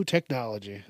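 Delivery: usual speaking voice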